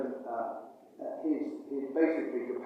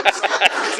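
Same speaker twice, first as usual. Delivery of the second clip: loud laugh; 4 semitones higher, 10 dB louder than usual. Real, not acted. Laughing at own doom goblin jibe.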